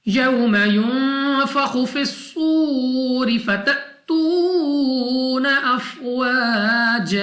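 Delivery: slow, drawn-out melodic tajweed recitation; Quran recitation. Acted. Teacher recites the verse for students, slow melodic tajweed chant. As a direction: chant each word slowly and melodically, stretching the vowels.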